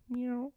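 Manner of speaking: high playful cat meow. Pretend. To viewers, joking about money in the kitty, a high meow.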